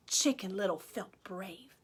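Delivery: hushed story whisper. A narrator's reading. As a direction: whisper the turning point. Narrator whispers.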